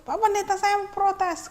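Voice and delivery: high, loud youth cutting in to protest; young man barges into the pastor's house, high and loud. Acted. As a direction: play the upset young man storming in.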